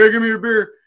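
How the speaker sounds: loud, high imitation of a demand; pushy demand. Troublemaker back two days later ordering, pushy demand. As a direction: mimic a pushy regular at the bar. Banned man demands beer from bartender.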